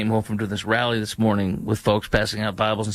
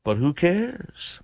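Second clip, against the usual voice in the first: mocking echo; 4 semitones higher, much slower than usual. Repeating the quote with scorn.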